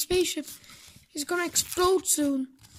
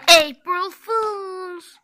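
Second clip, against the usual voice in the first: gleeful taunt; 3 semitones higher, 6 dB louder, much slower than usual. Acted. Prank reveal, loud and slow.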